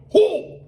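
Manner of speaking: "Hall" high and loud, vowel rounded, L dropped; New York pronunciation. Shown as a demonstration. Host repeating the kid's word, loud New York "Hall". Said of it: "That's an accent".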